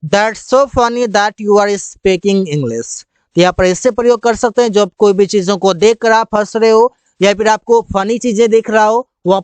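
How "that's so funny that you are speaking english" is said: stretched out very slowly; slow clear English. Shown as a demonstration. Teacher giving the English phrase slowly. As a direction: drill it word by word. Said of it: "मुझे हंसी आ रही है कि तुम अंग्रेजी बोल रहे हो।".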